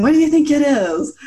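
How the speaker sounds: excited guessing. Retold or imitated. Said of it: "We kept going".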